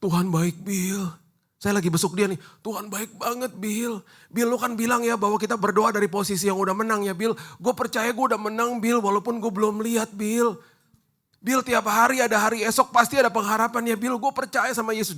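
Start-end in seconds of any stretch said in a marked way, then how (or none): 0.0-1.1 faint sick friend. Retold or imitated. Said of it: "udah lirih ngomongnya". 2.6-15.2 high, earnest voice of a dying friend; lightly played cancer patient, hopeful; sick friend's voice. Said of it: "udah lirih ngomongnya".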